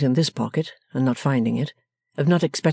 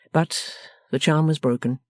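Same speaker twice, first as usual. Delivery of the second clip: usual speaking voice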